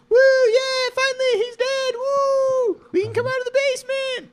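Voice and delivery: very high squealed celebration; hammed-up falsetto jubilation; mock cheering. Basement captives celebrating a death, squealed mock cheer. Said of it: "you'll die and they'll go".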